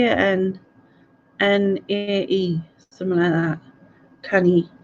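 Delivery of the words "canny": "Canny" for can't, ending in an ee sound; Glaswegian pronunciation. Shown as a demonstration. Scot shows how Glasgow says can't, Glaswegian "Canny". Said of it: "A Glaswegian would say canny".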